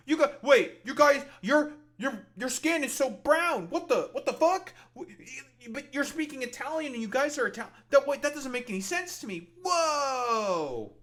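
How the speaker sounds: high, loud, hammed-up disbelief. Streamer imitates the vlogger in Sicily, shrill and hammed-up. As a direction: play a bewildered bigot, shrieking. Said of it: "Be like".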